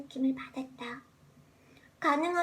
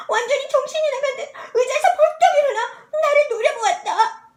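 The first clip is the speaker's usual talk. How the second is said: agitated narration; 8 semitones higher, 10 dB louder than usual. A narrator's reading. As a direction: voice rising, loud and frantic. Narrating his sudden frantic outburst.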